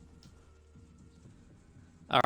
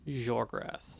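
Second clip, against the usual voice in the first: creepy voice; 3 dB louder, much slower than usual. Acted. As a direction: call his name like a lurking phantom. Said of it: "Except there's a creepy voice".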